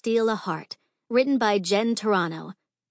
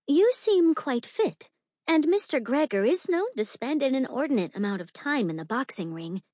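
put-on young society lady; 5 semitones higher than usual. Same young lady sizes up Gabriella.

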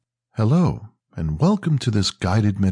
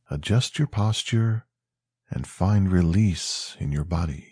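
guided meditation; 2 semitones lower than usual. Acted. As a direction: ease the listener toward sleep, gently.

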